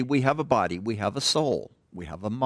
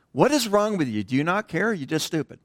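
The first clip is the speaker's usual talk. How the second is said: drill instructor yelling; 5 semitones higher than usual. Retold or imitated. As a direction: chew him out like a drill sergeant on the pad. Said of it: "but you can imagine".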